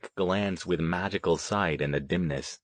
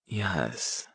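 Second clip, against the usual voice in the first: murmured; 2 semitones lower, 8 dB quieter than usual. A narrator's reading. Selina sensing something in the dark, murmured. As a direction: murmur it under your breath. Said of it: "Selina murmured".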